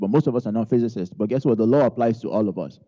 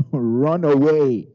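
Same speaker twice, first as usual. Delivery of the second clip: stretched-out, higher-pitched urging; slow, raised, acted warning; emphatic command; 4 semitones higher, 4 dB louder, much slower than usual. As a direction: preach the command, drawing out each word. Preacher quoting Paul's urgent command.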